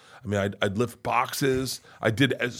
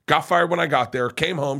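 ordinary delivery

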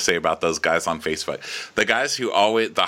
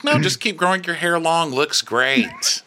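higher, mock-encouraging yes-man voice; fawning flattery; 8 semitones higher than usual. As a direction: gush like a flatterer.

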